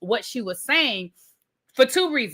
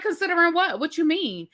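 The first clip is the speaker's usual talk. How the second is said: higher, incredulous demand; indignant question; 7 semitones higher than usual. Retold or imitated. Riley challenging Charlie's remark, pitched up in disbelief. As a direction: toss the question back in disbelief.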